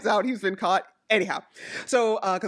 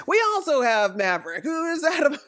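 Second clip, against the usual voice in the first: lightly voiced announcer, dissolving into laughter; imitated announcer commentary; 6 semitones higher, 3 dB louder than usual. Imitating announcers adding yet another feud, lightly voiced commentary. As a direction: announce it in mock commentary until amusement breaks it. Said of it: "announcers are, you know, doing their commentary".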